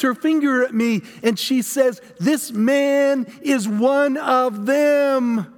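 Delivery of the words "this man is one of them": slow, higher, drawn-out denunciation; venomous accusation. Acted. Serving woman denounces Peter to onlookers, venomous. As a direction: point the finger with venom, every word stretched. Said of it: "such venom in her voice".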